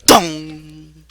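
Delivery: loud, booming "dong"; imitated impact. Game master voices Foxy getting bonked, loud imitated clang.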